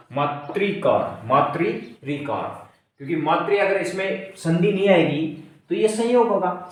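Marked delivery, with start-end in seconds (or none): 0.1-2.6 slow teaching pronunciation; slow demo. Shown as a demonstration. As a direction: say मातृकार then मातृ रिकार slowly.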